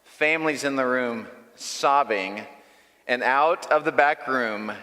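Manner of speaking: normal talking voice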